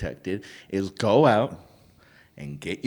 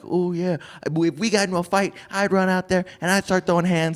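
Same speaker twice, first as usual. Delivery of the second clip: higher, fast tough-woman voice; 6 semitones higher, faster than usual. Retold or imitated. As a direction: play a brash woman bragging. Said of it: "some women are like".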